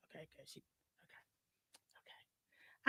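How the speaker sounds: breathy hushed okays under her breath; whisper. Real, not acted. Whispering to her cohost mid-segment, hushed whisper. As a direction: whisper it so the mic barely catches it.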